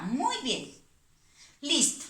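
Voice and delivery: normal talking voice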